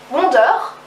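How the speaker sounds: English title said à la française; French accent. Shown as a demonstration. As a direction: say Wonder with French sounds, ending in -eur. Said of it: "à la française en mode". (accent français)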